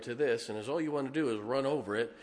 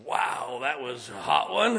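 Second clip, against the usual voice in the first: a louder hammed-up wow at the hot pepper; mock surprised wow; 7 dB louder than usual. Preacher mimics his sinuses after biting pepper, mock surprise. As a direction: play the burn of a hot pepper bite, a bit louder. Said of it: "your sinuses go".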